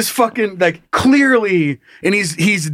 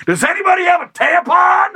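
high-pitched shriek mimicking a woman; screaming random lady; 12 semitones higher than usual. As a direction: holler it like a public announcement in a shrieked falsetto. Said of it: "some random lady was gonna come out".

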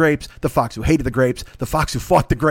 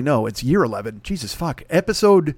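ordinary talk, nothing marked

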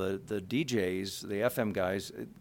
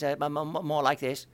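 higher, lighter voice for the stuttering brother; showing how Bobby sounded, pitched up; 5 semitones higher than usual. Shown as a demonstration. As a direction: pitch it up high to show his voice. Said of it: "Bobby was high-pitched".

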